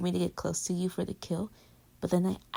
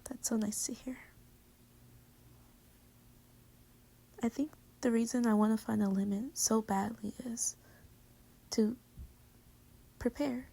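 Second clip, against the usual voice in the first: touched whisper; 3 dB quieter than usual. Real, not acted.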